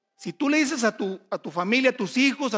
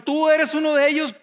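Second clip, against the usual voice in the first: accusing shout; 6 semitones higher, 6 dB louder than usual. Acted. Accusers confront Pedro at trial.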